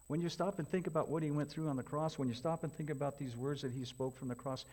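ordinary talk, nothing marked

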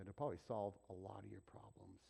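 ordinary talk

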